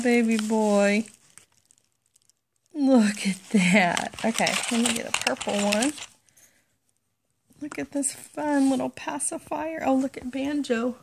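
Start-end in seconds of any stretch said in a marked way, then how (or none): none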